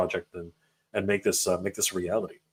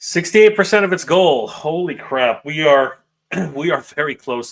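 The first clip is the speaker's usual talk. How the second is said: louder and higher, thrilled at the news; excited outburst; 5 semitones higher, 9 dB louder than usual. Real, not acted. Host reacting to the campaign total, real excited exclaim. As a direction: blurt it out in real delight. Host sees the funding goal progress.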